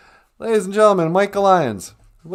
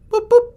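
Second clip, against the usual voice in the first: very high mock computer beeps; 6 dB louder than usual. Calling the spaceship computer with high mock beeps.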